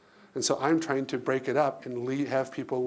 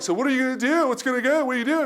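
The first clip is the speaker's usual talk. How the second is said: higher, louder volley of questions; eager questioning; 10 semitones higher, 4 dB louder, faster than usual. Retold or imitated. Organizers pressing him about his secret plan, eager and high.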